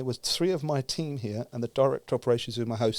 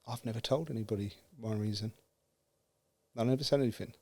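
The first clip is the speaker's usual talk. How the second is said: softly quoted, lower and halting; hushed confession; 3 semitones lower, 7 dB quieter, faster than usual. Retold or imitated. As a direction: retell her admission quietly, with pauses. Quiet confession by the director.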